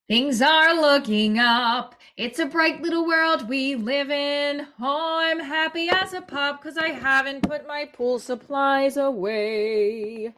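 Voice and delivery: high, slow, singsong fake cheer; mock cheerful. Single parent mocking forced morning cheer, bright singsong. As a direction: sarcastically chirp how great life is.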